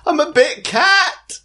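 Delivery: very high, drawn-out wail; slow high squeal in character; panicked guard. Acted. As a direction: stretch it out, absurdly high. Fleeing guard answers in character.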